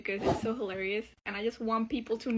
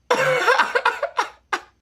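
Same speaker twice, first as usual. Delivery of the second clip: high, loud burst of laughter; long peal of high laughter; 13 semitones higher, 14 dB louder than usual. Real, not acted. Laughs at the story's absurdity.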